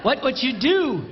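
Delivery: ordinary talk, nothing marked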